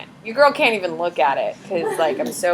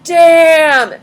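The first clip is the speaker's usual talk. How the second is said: mock amazed; 7 semitones higher, 6 dB louder than usual. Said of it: "other French people can be like".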